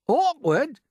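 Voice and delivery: very high, loud outraged Awkward; cried out. A narrator's reading. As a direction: shriek it back. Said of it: "cried the earthworm".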